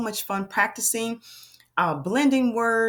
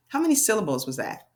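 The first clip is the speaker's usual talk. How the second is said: ordinary talk, nothing marked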